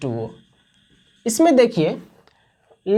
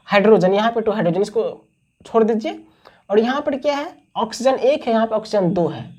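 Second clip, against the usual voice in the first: normal talking voice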